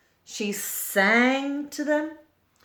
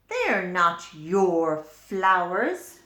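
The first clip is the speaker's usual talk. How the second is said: cross; 3 semitones lower, 6 dB louder, slower than usual. A narrator's reading. Mabel cross with George.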